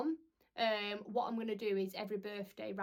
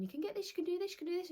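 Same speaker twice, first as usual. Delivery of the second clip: pushy shop staff; 9 semitones higher, much faster than usual. Retold or imitated. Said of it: "they're going".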